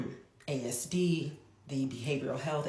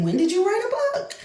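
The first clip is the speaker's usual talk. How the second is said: put-on much higher and louder, thrilled child; excited son; 11 dB louder, faster than usual. Mother imitating her son thrilled about her book, much higher excited child voice. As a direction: squeal it like an overjoyed boy. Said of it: "He was so super excited".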